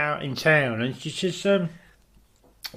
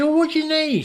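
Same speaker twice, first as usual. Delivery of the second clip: higher retold query; a raised, puzzled question; mock surprise; 10 semitones higher than usual. Asking his girlfriend what she needs abroad, mock surprise.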